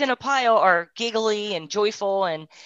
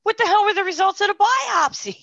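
much higher, louder demanding outburst; pitched-up exasperated demand; retold exasperation; 13 semitones higher, 5 dB louder than usual. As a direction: fire it at the doctor in disbelief. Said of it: "I said".

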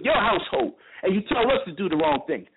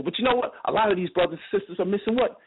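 usual speaking voice

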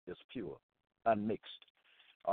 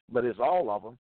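ordinary talk, nothing marked

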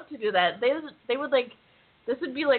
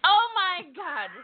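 mock outrage; 9 semitones higher, slower than usual. Real, not acted. Teased with an impersonation request.

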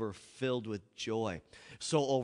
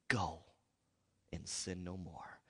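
tender Jesus; 5 semitones lower, 9 dB quieter than usual. Acted. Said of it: "the most loving, tender, caring voice".

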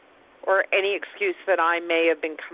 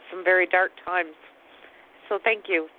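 ordinary delivery

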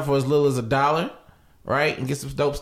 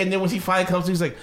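normal talking voice

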